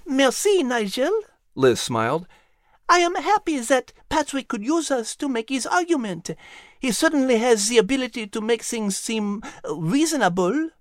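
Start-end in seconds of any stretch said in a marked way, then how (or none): 0.1-1.2 much higher, altered lady's voice; a fond lady asking for agreement; fond lady Liz. Acted. Liz thanks Nigel. 2.9-10.7 much higher, altered lady's voice; a fond lady asking for agreement; fond lady Liz. Acted. Liz answers Nigel.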